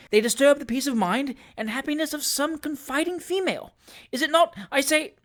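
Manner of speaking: high, righteous speechifying; indignant oration. A narrator's reading. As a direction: thunder on like an outraged orator. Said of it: "eloquent discourse".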